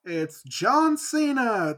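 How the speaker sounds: drawn out very slowly; slow dramatic John Cena reveal; slow meme announcement. Real, not acted.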